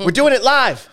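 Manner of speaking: loud, high mock rant; shouted catchphrase. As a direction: yell it like a TV meltdown. Mock Bill O'Reilly rant yell.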